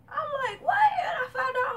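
very high, loud shocked cry; shocked shriek. Retold or imitated. Vlogger reliving the shock of the affair, shrieking. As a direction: shriek it like you just caught them.

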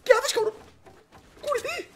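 very high-pitched, squeaky exclamation; alarmed squeal. Real, not acted. Streamer urging a player to take cover, alarmed squeal.

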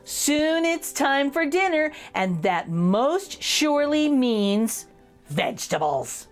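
ordinary delivery, nothing marked

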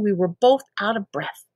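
ordinary delivery

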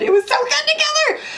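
leaps way up in pitch on together; gushing high squeal. Real, not acted. Beauty vlogger gushing to viewers over a lip color, squealing. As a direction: squeal it out with delight. Gushing repeat, much higher.

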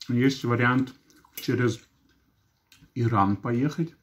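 talking around food; eating while speaking; mouth full, chewing. Real, not acted. Seen 1: Takes a forkful at -2, cheeks full and chewing while speaking.

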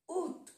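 "Août" said as "oot", T sounded; silent A. Shown as a demonstration. Teacher modelling the French month, "Août" without its A. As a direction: drop the A in "Août" and close on T. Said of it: "No se pronuncia la A".

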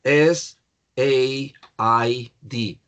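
very slow, letter by letter; drawn-out teacher's spelling; spelling aloud. Shown as a demonstration. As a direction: spell it out with space between letters. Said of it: "the spelling".

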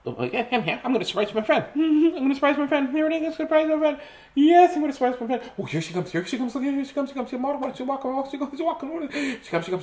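very high, giddy squirrel voice; excited squirrel. Acted. Squirrel waiting to surprise a friend, high excited chatter.

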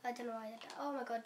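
ordinary delivery

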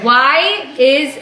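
word by word, with pauses, for a guesser; slow, spelled-out delivery. Pretend. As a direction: draw out each word clearly. Repeating slowly for guesser in headphones.

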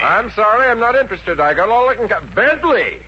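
high, loud, impatient brush-off; brusque refusal. Acted. Eddie cutting off the personnel manager, loud and high. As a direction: get him off the phone fast.

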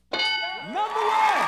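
long drawn-out high shout; calling out. Real, not acted. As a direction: stretch it like a game show host. Host calling for top answer.

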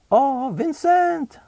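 high, slow and drawn-out lady's voice; high, slow, sweet older woman. Acted. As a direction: coo his name like a fond older lady. Missus Ray greets Vincent.

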